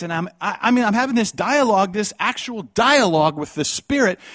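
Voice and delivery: high, loud impassioned preaching; full-force preaching. Acted. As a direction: pour it out with fervor.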